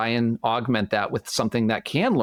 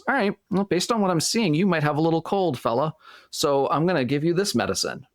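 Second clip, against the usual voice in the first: doctor voice; 6 semitones higher than usual. Pretend.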